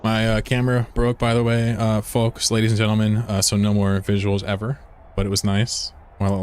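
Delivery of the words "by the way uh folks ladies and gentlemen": usual speaking voice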